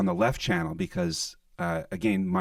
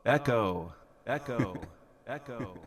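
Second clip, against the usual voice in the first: each echo fading softer; echo delay; 6 dB quieter than usual. Shown as a demonstration. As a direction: echo effect dying away. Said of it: "I'll put on some delay here".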